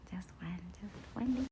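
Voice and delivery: soft, hushed whisper; conspiratorial whispered repeat; secretive whisper. Real, not acted. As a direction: whisper it as a sneaky little plea. Coaxing herself, repeats in whisper.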